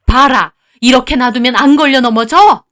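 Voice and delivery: loud accusing demand; angry scolding. Acted. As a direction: snap back into berating. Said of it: "표정을 확 바꾸고는". (버럭 다그치는 말투)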